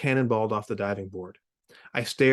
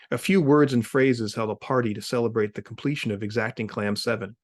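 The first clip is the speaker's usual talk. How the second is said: usual speaking voice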